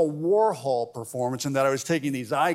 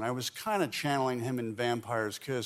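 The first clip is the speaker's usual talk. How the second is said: ordinary delivery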